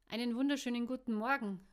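quick, loud good-humoured greeting; cheerful call. A narrator's reading. Man greeting his host in the kitchen, cheerful call. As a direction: call it out breezily, at a lively clip. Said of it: "rief er gut gelaunt".

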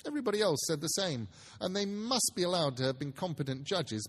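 ordinary delivery

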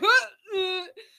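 high helpless squealing laugh; loud squeal of laughter; shrieking laugh while falling. Retold or imitated. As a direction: laugh out loud mid-tumble. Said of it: "I'm going".

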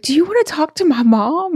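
put-on high, cheeky kid's offer; little girl voice. Child passing phone to mom, high kid voice. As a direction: hand it off like a clever child. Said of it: "She's like".

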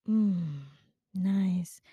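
mock the word nice in a whisper; hushed disdain for nice; whispered scorn. Real, not acted. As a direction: breathe the word nice as a voiceless sneer.

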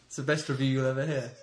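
ordinary talk

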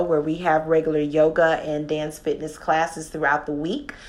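ordinary delivery

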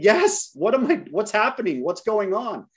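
high-pitched acted-out panic; freaking out. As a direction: blurt it out frantically, voice shooting way up. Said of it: "now I am freaking out".

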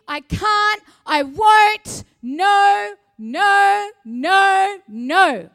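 mock despair swelling into shouted no no no; hammed-up negative voice shouting no; negativity building to shouts. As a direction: start defeated, build to loud refusals. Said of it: "Negativity says this".

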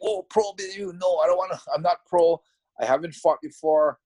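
reliving the shock of being offered a pro fight, protesting in alarm. Retold or imitated. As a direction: blurt it out like you've just been handed too much.

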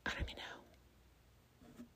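a soft whispered aside to herself; embarrassed whisper. Real, not acted. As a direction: whisper it as if covering an awkward silence. Embarrassed aside after a blank.

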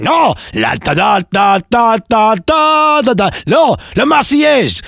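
very high, outraged French officer; French language police. Acted. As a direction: scold the parrot in a hammed-up French fury. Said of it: "imagine the language police turning up".

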